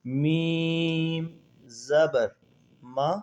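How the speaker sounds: slow drawn-out recitation of letters; spelling drill. Shown as a demonstration. Teacher slowly spelling Quran letters for students, drawn out. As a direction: chant each letter and vowel slowly.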